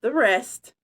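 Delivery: plain reading voice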